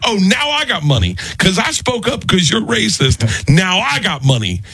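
sarcastic Tracy. Retold or imitated. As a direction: gloat at her, pitch way up. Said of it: "Tracy's like".

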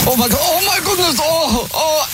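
high, hammed-up alarmed cries in a foreign accent; put-on accent, faked panic; mock panic. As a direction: fake a roof collapse, panicked. Prankster fakes panic on roof.

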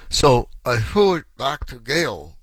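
slow, mumbled words through a jaw that barely moves; slurred, slow speech from a punched jaw; stiff-jawed talking. Shown as a demonstration. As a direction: talk as if your jaw is hurt and locked. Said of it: "like, talking like this".

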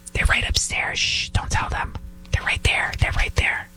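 hushed secret whisper, no voice; mocking whisper of informers pointing upstairs. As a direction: whisper as if hiding someone upstairs. Mock hiders whispering to Nazis.

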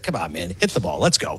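very low grumbled reply; unimpressed retort. Acted. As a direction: drop way down, like a fed-up fan. Fan's retort to stat-quoting hitter.

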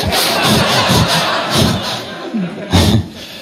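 breathy wheezing laugh with no voice; silent laughter. Real, not acted. Comic cracks up on stage.

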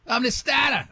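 exaggerated New England "stah-tah"; insane New England accent. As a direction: imitate the golf worker's New England accent. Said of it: "never heard an accent so insane".

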